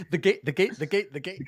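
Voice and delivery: high-pitched looped sample imitation; stuttering remix. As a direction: repeat it like a DJ chopping a sample.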